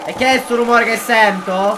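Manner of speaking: high, loud alarmed cry; startled shout. Real, not acted. Player spooked by a strange noise, shouting high and loud.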